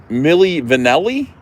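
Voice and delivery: [name] said Milli Va-NELL-i; mock Jersey pronunciation. Shown as a demonstration. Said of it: "It's not [name]".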